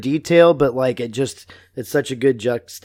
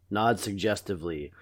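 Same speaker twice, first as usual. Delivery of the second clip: ordinary talk, nothing marked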